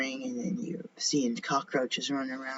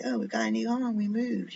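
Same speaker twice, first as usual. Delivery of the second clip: high, louder mock delight; playful high-pitched quote; imagined new arrivals; 8 semitones higher, 4 dB louder than usual. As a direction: announce it in a raised, put-on voice. Voices the bugs moving in.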